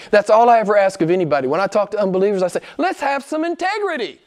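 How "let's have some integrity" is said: high-pitched preaching exhortation; impassioned challenge. Retold or imitated. Quotes own challenge to unbelievers.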